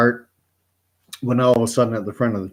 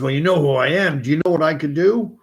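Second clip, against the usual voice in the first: high, loud, strange devil voice; menacing devil; 5 semitones higher, 3 dB louder, faster than usual. Acted. Satan in the church demands the old man know him, menacing. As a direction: loom over him as Satan.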